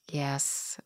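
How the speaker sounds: very soft, near-whispered answer; hushed meek reply. Retold or imitated. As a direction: barely voice it, as if answering a stern teacher. Meek reply to stern teacher, retold.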